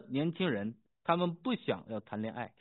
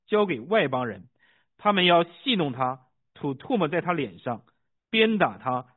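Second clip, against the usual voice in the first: measured, emphatic Bible reading; scripture reading; 2 semitones higher, 7 dB louder than usual. A narrator's reading. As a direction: read scripture aloud, punching key phrases. Reads scripture aloud.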